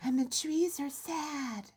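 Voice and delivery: slow, airy and high; breathy voice. Shown as a demonstration. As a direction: sigh the words out softly. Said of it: "I can't start doing that voice".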